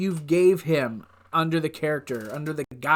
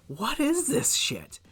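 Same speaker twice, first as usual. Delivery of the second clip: baffled; 8 semitones higher, 4 dB quieter, slower than usual. Retold or imitated. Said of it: "And I'm just".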